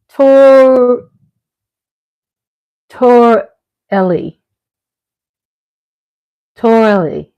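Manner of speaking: Torelli drawn out slowly as tor-ELL-ee; careful pronunciation. Shown as a demonstration. Host practicing an Italian name for listeners, careful pronunciation. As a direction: sound out "Torelli" slowly, piece by piece. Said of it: "it is pronounced".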